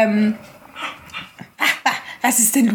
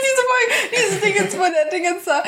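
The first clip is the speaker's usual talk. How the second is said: excited laughing; 13 semitones higher than usual. Real, not acted. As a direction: squeal it out with delight through laughter. Excited about trailer, laughing.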